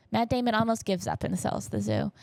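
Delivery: ordinary delivery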